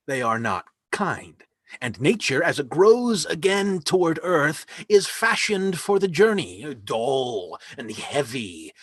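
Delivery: ordinary talk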